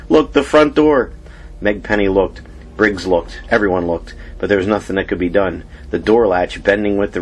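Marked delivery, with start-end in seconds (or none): 0.1-1.1 alarmed. A narrator's reading. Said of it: "cried someone".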